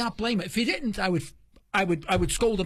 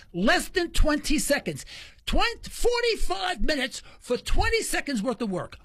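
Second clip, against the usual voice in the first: raised and higher, near a shout; outraged outburst; 6 semitones higher than usual. Real, not acted. Complaining about forty-five minutes spent for twenty seconds of work, near shouting. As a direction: let the outrage burst out over the wasted time.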